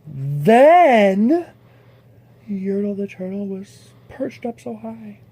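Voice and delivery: usual speaking voice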